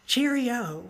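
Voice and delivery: lightly hammed English accent; badly faked British farewell; a touch of mock-British cheer; terrible British accent. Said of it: "In his terrible accent".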